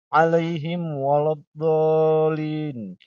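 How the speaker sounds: very slow alaihim waladdallin; slow recitation. Shown as a demonstration. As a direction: recite it drawn out. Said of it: "membaca ghairil maghdubi".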